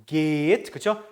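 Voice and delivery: ordinary delivery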